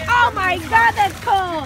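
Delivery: high, loud shocked gasp at cold. Real, not acted. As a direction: yelp at the icy water. Touching cold water.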